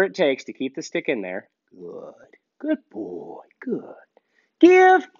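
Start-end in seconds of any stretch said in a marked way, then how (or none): 1.7-3.9 hushed praise for the dog; quiet praise; soft praise. Real, not acted. Soft praise holding stick. 4.6-5.1 high, loud dog command; firm training command; sharp command. Real, not acted.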